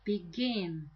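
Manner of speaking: Begin, weight landing on GIN; stress on second syllable. Shown as a demonstration. Teacher pointing out the stress, Begin stressed on GIN. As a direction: stress the end of Begin, then let it fall. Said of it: "and the stress is right there".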